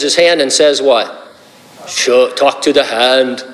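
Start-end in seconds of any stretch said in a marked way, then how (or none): 1.9-3.4 slow Terminator voice; deadpan robotic Arnold impression. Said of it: "Sounds just like Robert Shaw".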